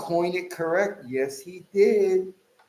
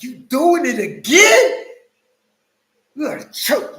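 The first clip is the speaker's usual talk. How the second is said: retold yelling; 9 semitones higher, 7 dB louder than usual. Yelling at the AI Numbers video again, mock rage. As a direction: shout at the screen in exasperation. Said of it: "I was yelling at the computer".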